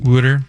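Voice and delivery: "Wooder" with a short oo vowel, for water; Philly pronunciation. Shown as a demonstration. Host corrects co-host's water, Philly "Wooder" with oo vowel. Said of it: "it's a great accent. I love it".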